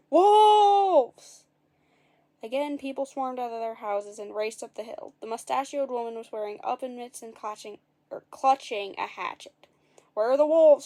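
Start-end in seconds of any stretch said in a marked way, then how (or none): 0.1-1.4 high, loud prank shout. A narrator's reading. Jack faking the alarm to the village, shouting. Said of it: "cupping his hands ... shouted". 10.1-10.9 loud, fierce demand. A narrator's reading. Angry woman confronting Jack, loud demand. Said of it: "she demanded".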